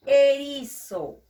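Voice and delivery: "erizo" slow and loud; emphatic word. Shown as a demonstration. Teacher presenting the picture word to pupils, loud and slow. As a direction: present "erizo" loudly and slowly. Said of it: "teacher shows the picture word".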